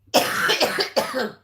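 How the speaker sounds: hard cough from a throat tickle; tickly cough. Real, not acted. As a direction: cough through a scratchy throat. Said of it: "I've given myself a tickle".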